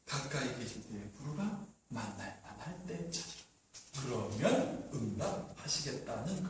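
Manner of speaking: very low, soft solemn recitation; hushed scripture quote. Acted. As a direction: drop right down for God's word. Solemnly quotes God's word to close.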